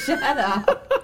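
laughing. Real, not acted. Laughing at husband's teasing.

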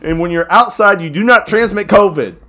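loud, high hammed-up hype; mock hype. To listeners, hammed-up loud praise of the sponsor. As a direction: rave about him like a pitchman.

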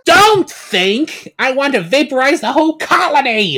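high, loud, rushing tirade; villain queen raging at Kegler; furious shouting. Acted. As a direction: scream down the henchman. Villain queen scolds henchman.

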